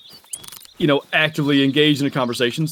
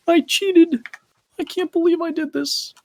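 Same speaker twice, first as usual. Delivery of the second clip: mock shock. Mock shock at own cheating.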